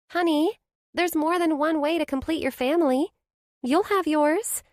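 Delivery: high, reassuring friend's voice; best friend, kind and sure; comforting Blair. A narrator's reading. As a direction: ease her friend's guilt.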